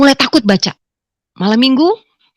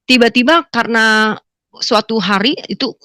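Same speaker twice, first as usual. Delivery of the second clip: usual speaking voice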